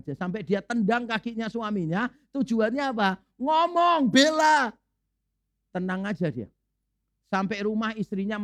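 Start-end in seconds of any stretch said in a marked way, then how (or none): 3.4-4.7 urgent nudge. Retold or imitated. Wife urging husband under table.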